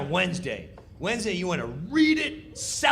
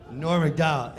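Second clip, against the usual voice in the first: usual speaking voice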